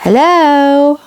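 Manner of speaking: high tiny voice; a small man calling up from under a daisy; little voice. Acted. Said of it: "a little voice".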